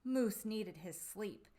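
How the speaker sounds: ordinary delivery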